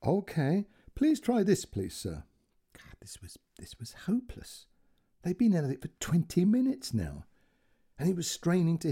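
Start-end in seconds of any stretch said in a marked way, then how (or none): 0.0-2.2 lightly voiced calm, polite call centre girl; soothing polite instruction, rising higher; a hint of a calm Indian operator. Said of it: "She sounded very calm". 2.7-4.7 whispered, despairing sigh of words; frustrated old man half-whispering; hopeless whisper. Acted. Frustrated inner aside.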